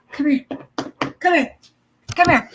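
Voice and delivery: high, brisk repeated call; calling a pet. Real, not acted.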